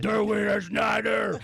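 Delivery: slow, booming mock-German bellow; demonstrative shout. Shown as a demonstration. Host showing how to say the name, slow hammed-up shout. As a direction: shout it out, stretching the words. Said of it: "I would say it like this".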